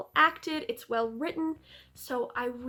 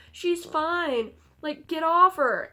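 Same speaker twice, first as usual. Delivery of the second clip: mock angry Jonathan; 5 semitones higher, 7 dB louder, slower than usual. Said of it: "is telling Van Helsing to get away from her".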